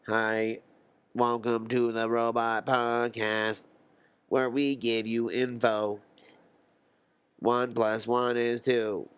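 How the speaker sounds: slow monotone robot voice; robot podcast host. Acted. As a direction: say each word slowly on one held pitch, like a robot. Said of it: "What if robots try to do podcasts?".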